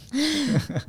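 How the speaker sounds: a burst of high squealing laughter; laughing high at an absurd text. Real, not acted. Friend laughs high at the creepy message.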